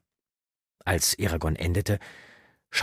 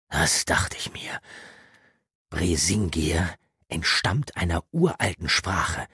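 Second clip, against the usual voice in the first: slow mysterious whisper; geheimnisvolles Flüstern. Acted. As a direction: share a secret in a whisper. (geheimnisvoll geflüstert)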